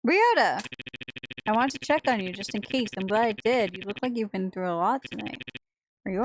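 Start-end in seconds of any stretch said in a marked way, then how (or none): 0.1-0.6 sudden loud cry; shocked to see him; startled cry. Acted.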